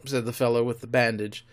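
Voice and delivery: usual speaking voice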